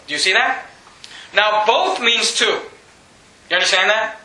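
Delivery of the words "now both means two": slow, deliberate, both stressed; emphasis on both. Shown as a demonstration. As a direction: lean hard on both, slowly. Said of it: "notice the next word".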